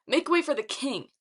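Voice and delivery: show-off's loud call; cocky proclamation. A narrator's reading. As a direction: proclaim it to the crowd with swagger. Leo shows off loudly.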